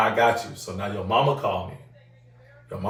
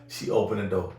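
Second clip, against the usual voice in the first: ordinary delivery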